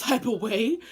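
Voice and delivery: ordinary talk, nothing marked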